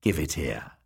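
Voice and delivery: soft, low crone whisper; eager whisper. Acted. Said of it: "she whispered".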